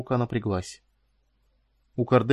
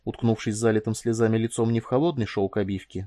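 ordinary delivery, nothing marked

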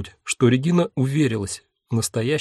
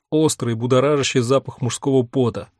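plain reading voice

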